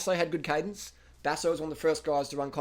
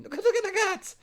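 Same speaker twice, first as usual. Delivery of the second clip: high-pitched excited burst, much higher; 5 dB louder than usual. Real, not acted. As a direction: squeal it out in delight. Excited at Froome's cadence.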